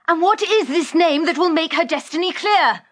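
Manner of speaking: high, ringing, passionate demand. Acted. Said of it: "her voice was all bronze now and ringing with passion".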